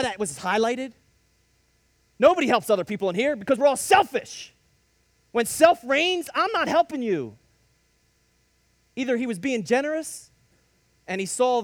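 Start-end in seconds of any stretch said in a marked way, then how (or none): 2.2-7.4 much higher and louder, driving the point; preaching at full force. Acted. Preacher hammering home selfishness to the congregation, loud and high. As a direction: preach it hard to the back row.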